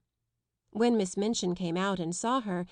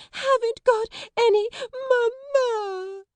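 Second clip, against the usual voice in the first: very high, loud crying wail; spoiled girl screaming for her mother; 7 dB louder, slower than usual. A narrator's reading. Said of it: "Lottie wailed more loudly".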